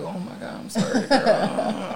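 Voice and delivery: slow soft laughing apology; giggly sorry-girl voice. Retold or imitated. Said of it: "I'm like".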